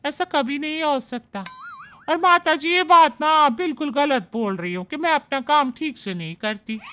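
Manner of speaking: chatty, self-praising house maid; maid's voice. Acted. Maid Manju defends her work to Shobhna, chatty and boastful. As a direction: boast about your own work.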